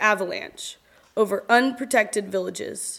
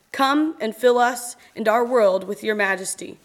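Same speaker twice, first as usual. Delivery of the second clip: usual speaking voice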